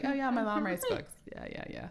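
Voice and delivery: lightly voiced unimpressed son; blasé kid. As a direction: mimic a child who can't be bothered. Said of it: "He's like".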